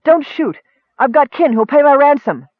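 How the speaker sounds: high, loud frantic begging; terrified captive shouting for mercy; panicked pleading cry. Acted. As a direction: beg for your life at full voice. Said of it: "the plain-faced woman cried".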